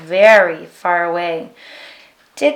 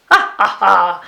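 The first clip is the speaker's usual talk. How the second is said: loud hearty ha-ha-ha; Viking belly laugh; 2 semitones lower, 3 dB louder than usual. A narrator's reading. Viking laughs as they row after the fruit, hearty laugh. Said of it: "The Viking laughed".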